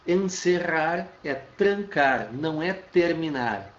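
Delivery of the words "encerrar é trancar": "Encerrar é trancar," slow, stress on "Encerrar"; slow emphatic. Shown as a demonstration. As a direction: lean on "Encerrar" slowly. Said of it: "teacher repeats definition".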